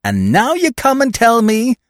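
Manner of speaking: high, loud exasperated tirade; exasperated scolding. Acted. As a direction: scold a bungling accomplice. Miss Peavy scolds her accomplice.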